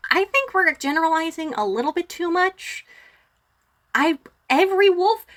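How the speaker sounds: high-pitched, doubtful councillor voice; Periwinkle objecting. Acted. Periwinkle pushes back on Shumley's generalizing, high and protesting. Game master plays Periwinkle.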